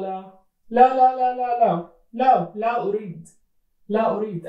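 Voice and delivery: put-on a scared boy begging not to go; panicked refusal.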